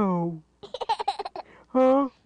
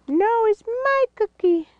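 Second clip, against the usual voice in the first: high squeaky cartoon voice; high toy voice. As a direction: make the toy squeak its line. Voicing a toy in play.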